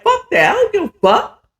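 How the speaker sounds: high, laughing dismissal; defiant Champ. Retold or imitated. Champ brushing off Akeem's warning, high and laughing. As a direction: toss it off with a reckless laugh. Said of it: "Champ was like".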